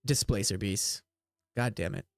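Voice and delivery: low elf voice; grim elf. Acted. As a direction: drop low for the elf's grim verdict. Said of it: "the elf just goes".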